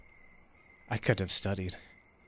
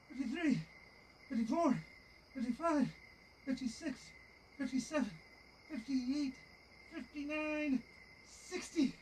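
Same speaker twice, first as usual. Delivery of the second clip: loud; 10 semitones higher, 7 dB louder than usual. Real, not acted. Counting out plank seconds.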